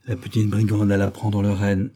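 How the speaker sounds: ordinary delivery, nothing marked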